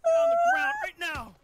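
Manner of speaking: high, loud barked order; commanding yell. Acted. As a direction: yell them to the ground. Ordering the attackers down.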